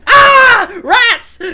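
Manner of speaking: high, loud startled yell; battle yell. Acted. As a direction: shout it as the rats charge. Character battle cry.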